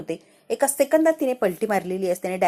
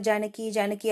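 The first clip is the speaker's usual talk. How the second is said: usual speaking voice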